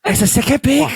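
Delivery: high-pitched incredulous question; real disbelief. Real, not acted. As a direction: question it, genuinely taken aback. Disbelief at the surprise pick.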